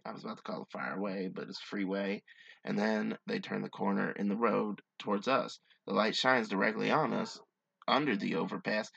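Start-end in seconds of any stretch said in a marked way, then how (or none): 2.6-8.9 low, measured storytelling read; hushed-down, deliberate campfire-tale delivery; story narration. A narrator's reading. Reads a story aloud.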